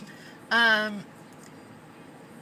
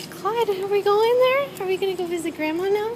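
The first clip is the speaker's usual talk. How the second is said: much higher, louder, fast doggy talk; 11 semitones higher, 6 dB louder than usual. Real, not acted. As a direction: hype the puppy up for the visit. Excited baby talk to dog.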